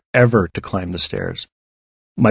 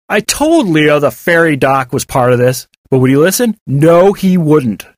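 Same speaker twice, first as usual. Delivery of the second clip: scornful complaint, raised and louder; sneering; 6 semitones higher, 4 dB louder than usual. A narrator's reading. David grumbles that Leo ignored him, sneering. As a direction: sneer it, dragging out the last answer. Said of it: "David sneered".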